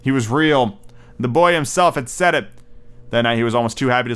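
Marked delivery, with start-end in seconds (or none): none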